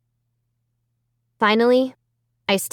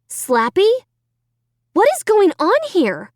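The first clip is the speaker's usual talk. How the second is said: high, alarmed question; bewildered; 11 semitones higher, 4 dB louder than usual. A narrator's reading. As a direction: demand an answer from the dummy. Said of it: "I asked out loud".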